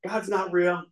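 mock-furious rant at God, half reliving it; retold angry rant. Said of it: "I just go off on him".

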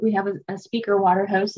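ordinary talk, nothing marked

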